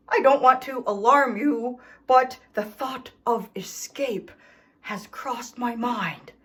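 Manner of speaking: ordinary delivery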